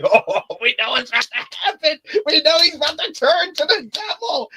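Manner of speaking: high, loud hyped squeal; near-squealing with excitement. Retold or imitated. Fan hyped for Daredevil's fight scene, high excited squeal. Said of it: "I'm like".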